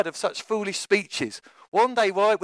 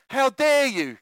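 mild indignant shout; 6 semitones higher, slower than usual. A narrator's reading. King rebuking the wave at his feet, mild indignant shout. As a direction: scold the waves like an affronted king. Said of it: "Canute shouted".

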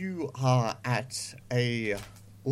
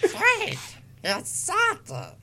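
slow, pitched-up duck voice gone wrong; Stitch; slower than usual. Acted. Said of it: "that's still Stitch".

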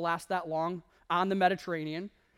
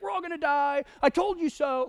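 high, loud mocking I-told-you-so; angry shaming; 8 semitones higher, 4 dB louder than usual. Preacher mocks an angry Paul shaming the sailors, high and loud. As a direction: whine it out like a scold who gloats. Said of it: "shaming them because he's angry".

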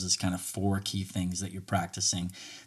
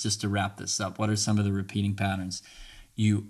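normal talking voice

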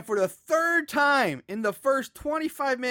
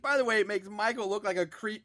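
normal talking voice

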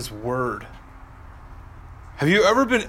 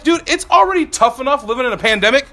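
exasperated rant; 7 semitones higher than usual. Retold or imitated. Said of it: "that I'm like".

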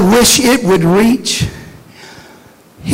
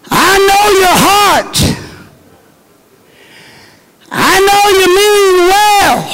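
a hint of God speaking kindly; God gently reassuring a doubter; voicing God; 12 semitones higher than usual. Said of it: "It's God's way of saying".

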